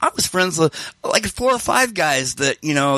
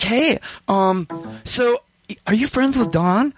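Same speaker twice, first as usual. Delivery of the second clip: put-on lightly pitched-up girl's voice; higher, tentative chick voice; young woman; 7 semitones higher than usual.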